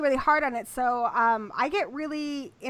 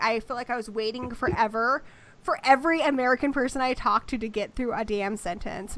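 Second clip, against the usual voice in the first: usual speaking voice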